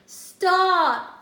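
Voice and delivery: high, loud yelled command; panicked yell. A narrator's reading. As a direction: yell it to halt the pot. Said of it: "yelled Big Anthony".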